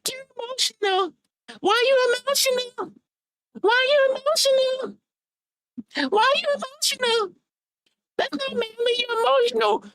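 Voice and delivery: high, whiny mock of the other guy; mocking falsetto needling. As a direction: squeak it out like a mocking imitation. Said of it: "he trying some more Shangri language".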